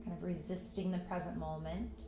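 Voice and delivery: calm meditation voice with long pauses; unhurried, even guidance into stillness; guided meditation. Acted. Yoga teacher settling students into breath and patience, guided meditation.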